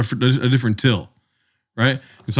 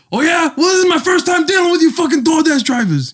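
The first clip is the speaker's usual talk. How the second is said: angry yell. Retold or imitated. Parking attendant yelling at the DoorDash driver, furious shout. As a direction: bellow it like he's lost it.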